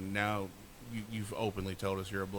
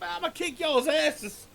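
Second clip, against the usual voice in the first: mock threat; 7 dB louder, slower than usual. Friend threatens after the prank.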